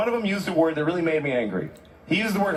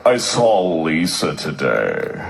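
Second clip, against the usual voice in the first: imitating a slow dull guy; much slower than usual. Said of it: "So his friend goes".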